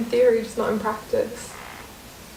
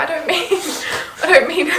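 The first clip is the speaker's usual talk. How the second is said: laughing; 7 semitones higher, 5 dB louder, faster than usual. Real, not acted. Laughing nervously while explaining.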